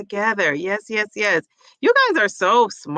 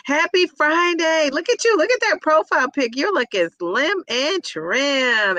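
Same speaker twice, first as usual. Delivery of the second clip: excited greeting; 7 semitones higher than usual. Real, not acted.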